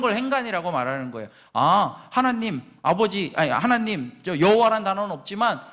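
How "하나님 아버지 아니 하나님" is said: normal talking voice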